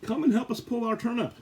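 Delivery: a small dog begging the kitten for help; puppy calling. Acted. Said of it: "called to Anya, the kitten".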